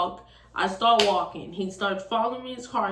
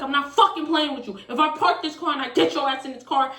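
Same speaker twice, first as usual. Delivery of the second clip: raised, louder mock threat; angry threat; 6 semitones higher, 4 dB louder than usual. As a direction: imitate his heated warning. Boyfriend threatens her.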